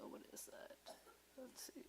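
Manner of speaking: soft unvoiced whisper. Real, not acted. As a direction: whisper the question to yourself. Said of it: "whispering to herself".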